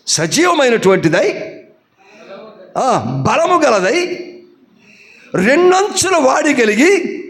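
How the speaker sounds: slow, rising preacher's proclamation; proclaiming scripture. Acted. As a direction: lay out each phrase slowly, building to a shout.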